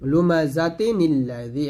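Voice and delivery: লুমাজাতি নিল্লাযী chanted slowly, tanwin joined; slow tajweed reading of লুমাজাতি নিল্লাযী; recitation demo. Shown as a demonstration. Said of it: "এরকম".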